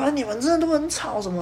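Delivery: high-pitched imitation of a nagging neighbor; exaggerated complaint. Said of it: "后来就是变成说".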